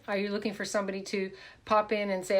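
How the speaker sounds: normal talking voice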